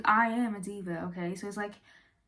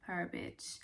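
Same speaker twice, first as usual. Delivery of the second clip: whisper; 3 semitones lower, 14 dB quieter than usual. Real, not acted. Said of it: "whispered aside".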